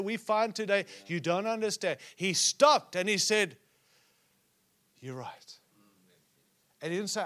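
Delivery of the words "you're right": very soft, low quoted confession; quiet, humbled retelling; hushed admission. Retold or imitated. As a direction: drop almost to nothing, owning up. David admits his guilt.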